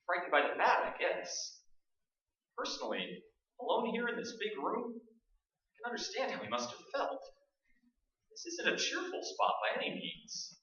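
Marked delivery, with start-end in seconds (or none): 2.6-10.6 uneasy hush. Acted. Alone in spooky room.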